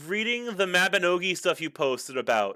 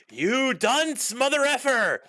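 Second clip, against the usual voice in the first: villain meme voice; 10 semitones higher, slower than usual. Retold or imitated. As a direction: shout it like the cartoon villain. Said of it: "If we were in The Incredibles, you'd be".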